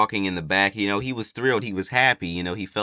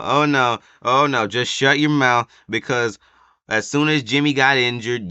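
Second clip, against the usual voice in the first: imitating a yell; 5 semitones higher, 7 dB louder than usual. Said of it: "he was like".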